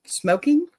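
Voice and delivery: high, rising disbelief on Smoking; stunned repeat of the word; incredulous. Real, not acted. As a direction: echo it in shocked disbelief. Disbelieving echo of husband.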